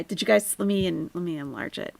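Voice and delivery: normal talking voice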